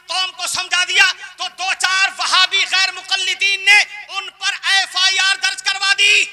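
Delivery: loud sermon building to a high shout; full-force preaching. Acted. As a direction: build to a shouted accusation of the congregation. (ज़ोर पकड़ती, चिल्लाती हुई तक़रीर)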